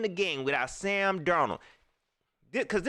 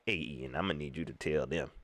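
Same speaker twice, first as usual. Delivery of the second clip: much lower, softer put-on coach; mock coach voice; 8 dB quieter than usual. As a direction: mumble it like the coach tipping off a reporter. Mocking coach calling the reporter.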